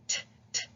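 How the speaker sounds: short unvoiced taps, t t t; tapping T sound. Shown as a demonstration. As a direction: tap the tongue, t. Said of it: "That's what your mouth should be doing".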